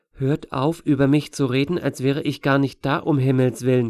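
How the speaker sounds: usual speaking voice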